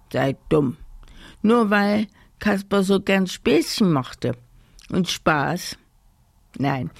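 plain reading voice

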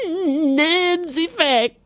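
high, drawn-out panicked wail; frightened scream. A narrator's reading. Giantess to the knight about the spider, screaming in fright. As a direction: cry out in terror of a spider. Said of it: "schrie sie".

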